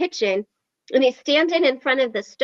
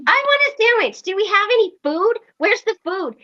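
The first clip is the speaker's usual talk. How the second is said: mock hungry husband; 9 semitones higher than usual. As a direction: ham up a husband wanting food. Wife voicing hungry husband.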